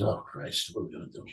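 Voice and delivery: low, hushed groan of dismay; a retold muttered oh Christ. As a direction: sink under the bad news, half-whispered. Retold dismay at friend's gambling.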